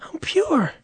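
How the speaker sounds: tiny, very high soft voice; mock innocence. Comic assuring ladies of his purity, high mock innocence. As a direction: squeak it softly, as if pure.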